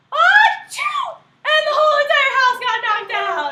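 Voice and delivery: huge hammed-up sneeze into laughing shout; big sneeze. Wolf sneezing the stick house down, laughing shout.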